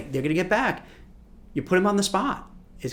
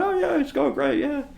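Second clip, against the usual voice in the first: breezy staff reply; 10 semitones higher than usual. Retold or imitated. Staff answering senior boss breezily.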